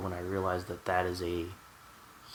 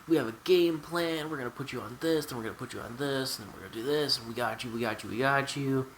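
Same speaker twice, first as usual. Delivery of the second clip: higher-pitched, sing-along mock doctor spiel; imitating the doctor; 5 semitones higher than usual. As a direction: reel it off like a doctor's rehearsed reassurance. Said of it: "And he's like".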